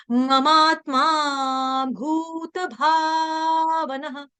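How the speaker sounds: very slow, with each भ in भावनः clearly breathy; careful recitation. Shown as a demonstration. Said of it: "जैसे मैंने अभी बता दिया". (धीमा श्लोक पाठ, भ पर ज़ोर)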